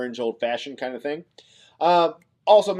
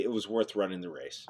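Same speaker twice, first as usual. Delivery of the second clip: softer, sincere consolation; consoling; 6 semitones lower, 10 dB quieter than usual. Real, not acted. Host consoling a viewer whose racer lost, softly. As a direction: let him down gently.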